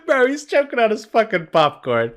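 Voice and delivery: high, loud laughter bubbling through the words; cracking up at the guy choking on popcorn; laughing while talking. Real, not acted. Watching the clip, laughing at the popcorn guy. Reacting to the actor on stage.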